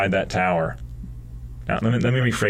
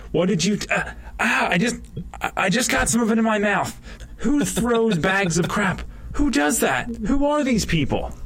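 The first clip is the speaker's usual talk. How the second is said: much higher, frantic disgust; panicked squeal; 11 semitones higher than usual. Retold or imitated. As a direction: shriek it in outrage. Soldier panicking, pitch soaring.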